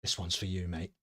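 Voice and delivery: low, soft aside; Borky. Acted. Sideline official confiding in the player, low and soft. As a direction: drop it down low and quiet.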